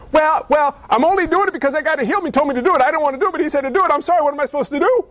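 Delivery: high, hurried whiny-chicken protest; cowardly excuse. Acted. Healed man blaming Jesus to the authorities, high cowardly excuse-making. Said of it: "The man's a chicken".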